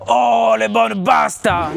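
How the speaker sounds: high, slow, drawn-out mock-Italian cry; exclaiming grandfather. Grandfather greeting plates of pasta, booming fake-Italian exclamation. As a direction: bellow it out like a delighted old papy. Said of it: "mon grand-père, il me sort".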